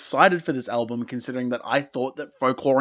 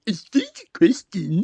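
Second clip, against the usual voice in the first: higher, lightly mocking sing-song; mocking the lyric; 8 semitones higher than usual. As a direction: mimic the lyric to tease it. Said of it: "the very triggering".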